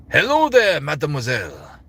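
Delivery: high French-accented greeting, falling away; Lumiere. Shown as a demonstration. Streamer finding the character's voice, high French-accented greeting. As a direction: call out grandly in French accent, then drop. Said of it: "That's kinda like right there".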